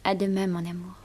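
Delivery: soft, quiet goodbye; tender farewell. Acted. Girl bidding her lover goodbye, tender. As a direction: let it fall soft and loving.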